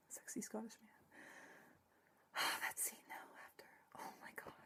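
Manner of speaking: swooning whisper. Real, not acted. Fan swooning over Rumpel to the camera, whispering. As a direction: breathe it out in a whisper.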